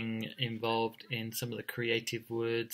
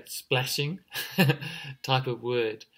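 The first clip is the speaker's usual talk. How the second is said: usual speaking voice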